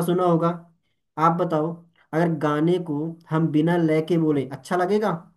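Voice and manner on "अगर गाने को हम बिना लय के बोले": ordinary talk, nothing marked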